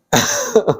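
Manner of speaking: short high laugh; a burst of real laughter. Real, not acted. Laughs at own joke. (tawa singkat bernada tinggi)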